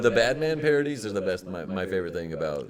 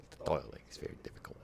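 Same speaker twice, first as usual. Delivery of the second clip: whispering; 6 semitones lower, 14 dB quieter, faster than usual. Real, not acted. Whispered aside.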